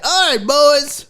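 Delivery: put-on rally the boys with a folksy speech; earnest mock locker-room motivation; coach pep talk. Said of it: "some coaches are like".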